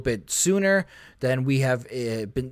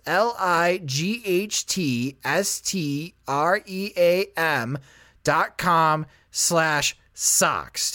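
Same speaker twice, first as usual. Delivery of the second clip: careful, deliberate letter-by-letter read; slow spelling out; much slower than usual. Acted. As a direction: spell each letter slowly and clearly. Spelling out the web address.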